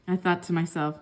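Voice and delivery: ordinary delivery, nothing marked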